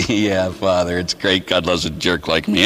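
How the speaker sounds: low, resigned mock reply; resigned agreement. His sheepish reply to the director.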